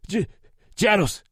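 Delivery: high, loud stammering scream. A narrator's reading. Captive screaming the town name to Lou. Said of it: "the man screamed".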